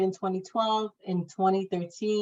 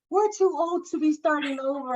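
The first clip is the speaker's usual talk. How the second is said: worried husband; 9 semitones higher than usual. Retold or imitated. Husband worries about age.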